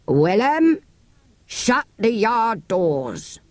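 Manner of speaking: slow, curt order; clipped command that shuts the trap; short and curt. A narrator's reading. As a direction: dealer curtly ordering the ostler to lock up. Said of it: "he said shortly".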